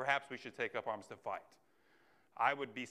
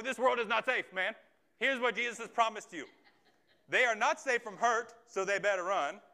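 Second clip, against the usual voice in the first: rising, louder sermon recap pushed higher; preaching at full force; 7 semitones higher, 4 dB louder than usual. Acted. Pastor driving home the recap to the congregation, preaching hard. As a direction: preach it, building urgency.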